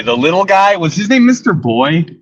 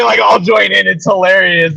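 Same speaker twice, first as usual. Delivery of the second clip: ordinary talk, nothing marked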